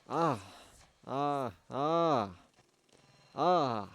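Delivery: loud, high pained cries. Acted. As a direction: cry out in pain again and again. Memories flooding back painfully.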